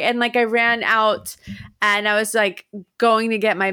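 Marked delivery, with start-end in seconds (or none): none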